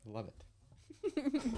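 tiny squeal of delight; squeaky high voice. Real, not acted. Gushing over cute idea.

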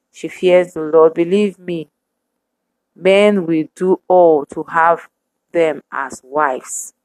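ordinary talk, nothing marked

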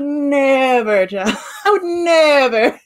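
drawn-out, dramatic "Never"; sassy never. Pretend. Herself now, waving off a pushy man, sassy. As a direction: sass the word "Never" like a diva. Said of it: "I keep saying it like that".

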